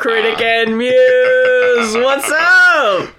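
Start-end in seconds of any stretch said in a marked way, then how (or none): 1.9-3.2 hype greeting. Acted. Podcast greeting in put-on voice.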